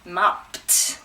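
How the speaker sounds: "Mopped" ending in a released pt; t sound ending. Shown as a demonstration. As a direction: say "Mopped" as one syllable with a crisp t. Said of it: "t sound".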